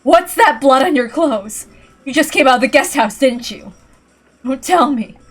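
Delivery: a woman shouting at her bloodied rival; alarmed confrontation. Acted. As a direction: demand an answer from the bloodied killer. Ava confronts bloodied Kiri.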